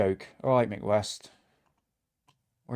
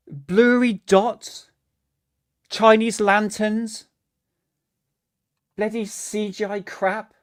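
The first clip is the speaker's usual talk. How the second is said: scornful; 6 semitones higher, slower than usual. Real, not acted. Mocking the footage.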